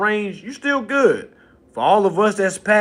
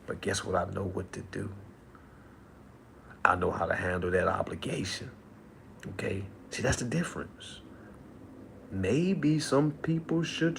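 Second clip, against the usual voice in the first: quiet confiding; 8 semitones lower, 14 dB quieter than usual. Acted. Low, soft confiding aside.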